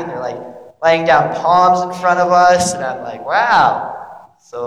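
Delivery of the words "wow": wow with a big rise and fall; taken aback by the spectacle; amazed. Acted. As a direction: swoop up and down in wonder. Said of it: "everybody's so happy".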